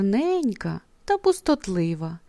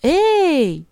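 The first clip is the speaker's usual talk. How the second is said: calling out; 6 semitones higher, 3 dB louder than usual. A narrator's reading. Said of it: "гукає хмаринка".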